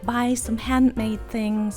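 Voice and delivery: usual speaking voice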